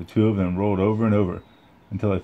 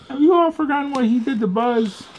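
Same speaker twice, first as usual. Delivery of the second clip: much higher outraged rant; rally the toys against him; indignant accusation; 3 dB louder than usual. Acted. Potato Head accuses Woody.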